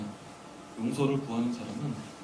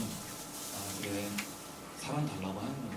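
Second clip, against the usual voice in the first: understanding; 3 semitones lower, 9 dB quieter, slower than usual. Retold or imitated. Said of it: "이렇게".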